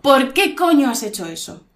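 emphatic angry demand, falling tune. Shown as a demonstration. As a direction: demonstrate anger, leaning on "coño". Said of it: "Estoy enfadada".

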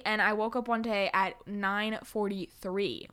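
ordinary delivery

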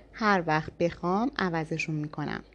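plain reading voice

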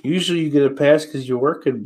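usual speaking voice

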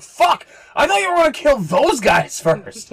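high, loud outraged yell; pained angry shout. Acted. Stabbed leader yelling at the rogue, high outraged shout. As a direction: yell it out after the hit.

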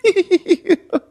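high-pitched laugh. Real, not acted. Pastor laughing after his joke, high laugh.

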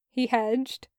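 ordinary delivery, nothing marked

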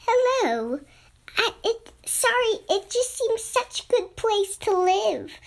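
a tiny caterpillar sheepishly explaining itself; small high timid caterpillar voice; little voice. A narrator's reading. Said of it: "a much littler voice".

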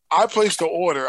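ordinary delivery

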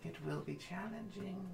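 slow eerie seer voice; a hint of a spooky oracle; creepy oracle voice. GM voicing oracle figure.

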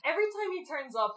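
ordinary talk, nothing marked

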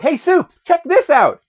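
high-pitched put-on voice; mock excited falsetto; Lois calling out. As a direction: call out high, showing off a find. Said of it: "Lois is like".

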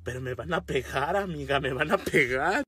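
low, hushed plea; acted timid objection, kept small; meek protest. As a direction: plead softly under your breath.

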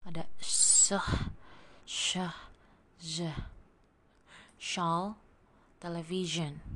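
hissed "se" then buzzed "ze", "Shawl" then "television"; contrasting s and z sounds. Shown as a demonstration. Teacher contrasts voiceless and voiced sounds for students. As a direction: make the pair sound clearly different. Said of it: "Beda kan?".

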